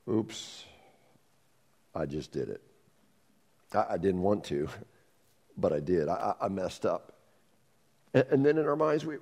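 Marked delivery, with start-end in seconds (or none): 0.1-7.1 soft and low, let down; deflated confession. Acted. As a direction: admit it quietly, crestfallen. Acted sinner admits failure.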